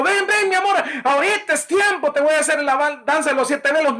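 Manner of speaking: mock seductive wife. Pastor joking about Hannah calling her husband, mock seductive.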